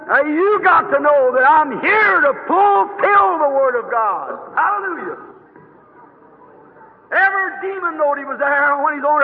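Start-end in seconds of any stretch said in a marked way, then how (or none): none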